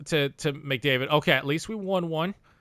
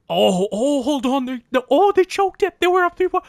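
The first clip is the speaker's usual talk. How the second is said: much higher, louder excited shout; 8 semitones higher, 8 dB louder than usual. Real, not acted. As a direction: shout with disbelieving glee. Vancouver collapses in series.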